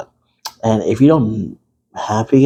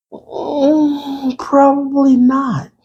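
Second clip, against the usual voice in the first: much higher, slow doubtful hum; much higher drawn-out no; skeptical; 14 semitones higher, much slower than usual. Acted. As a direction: draw it out in mock disbelief. Doubtful answer about kids.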